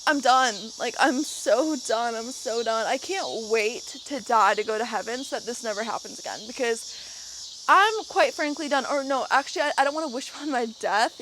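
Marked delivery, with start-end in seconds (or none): 0.1-0.5 loud, fed-up blurt; exasperated outburst. Retold or imitated. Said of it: "I'm just like". 0.8-8.9 hammed-up despair. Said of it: "I'm just like".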